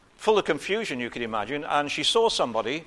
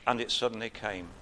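ordinary talk

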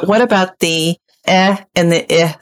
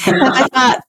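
ordinary talk, nothing marked

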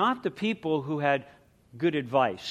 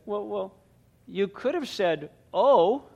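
put-on tearful little girl; 6 semitones higher, 3 dB louder, slower than usual. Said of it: "tears brimming, lip quivering".

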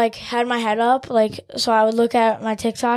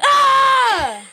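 high, loud "Ah!"; re-enacted loud scream; 13 semitones higher, 6 dB louder than usual. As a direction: mimic a startled scream at full pitch. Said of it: "That was really loud".

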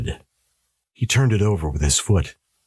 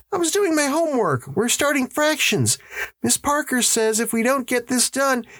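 put-on very high child's voice; protesting boy. A narrator's reading. Son explaining his homework to his father, boyish protest. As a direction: play a schoolboy making his excuse.

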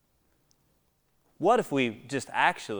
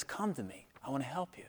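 tender; 12 dB quieter than usual. Acted. Jesus speaks tenderly.

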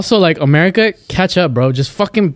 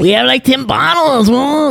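high, drawn-out mock party whoop; acting crazy; 10 semitones higher, slower than usual. Friend clowns for officers about bottles, acting crazy. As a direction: stretch it out way up high, hammed-up. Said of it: "You just start getting crazy".

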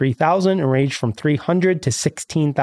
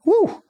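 short high "woo"; amazed whoop. Real, not acted. Reacting to the cost of a leopard hunt, high whoop. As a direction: let out a high whoop at the figure.

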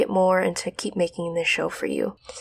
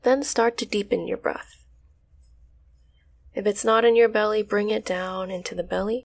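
guided meditation. Acted. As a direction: ease them into stillness.

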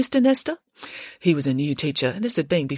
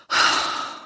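long tired breathy sigh; weary sigh. Acted. Exhausted Friday sighs at the school drama, long and weary. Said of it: "Friday sighed".

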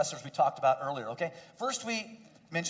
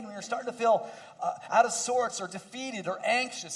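raised, emphatic repeated declaration; building preaching refrain; 4 semitones higher, 3 dB louder than usual. Acted. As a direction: preach the refrain with rising conviction.

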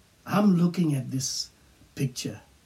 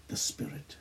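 faded whispered ending; hushed trailing whisper; 14 dB quieter than usual. Real, not acted. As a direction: let it fall to a whisper. Whispered close.

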